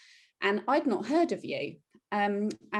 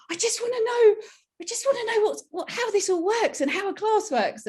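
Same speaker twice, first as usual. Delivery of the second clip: high, louder, pleading to know; eager whine; 13 semitones higher, 4 dB louder than usual. Retold or imitated. Telling how she longed to learn the seven steps, high and pleading. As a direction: beg like a child who can't wait.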